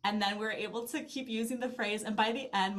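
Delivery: usual speaking voice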